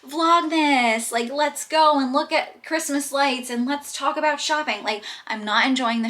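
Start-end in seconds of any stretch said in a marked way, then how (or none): 0.1-4.8 high, loud, bubbly vlogger voice; mock the cheery Christmas vlogger; peppy YouTube girlie. Vlogger mocking cheery YouTube girlies, bubbly and loud. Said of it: "one of those girlies on YouTube that's like".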